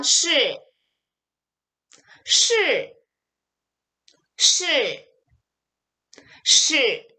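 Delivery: 실 with curled tongue and a sharp fall; correct falling shi. Shown as a demonstration. Teacher drills the correct answer, falling retroflex 실. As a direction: drop sharply from high on a retroflex 실. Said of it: "이렇게 들어가셔야 돼요".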